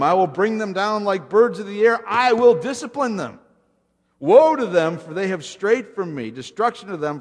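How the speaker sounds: loud divine tirade, easing toward the end; angry outburst. Acted. God judging a rebellious people, angry outburst. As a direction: thunder out the judgment, then let it drop. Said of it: "God's angry outburst".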